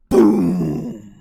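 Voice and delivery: very loud booming blast; loud mock explosion; explosive boom. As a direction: belt out the explosion. Explosion sound effect.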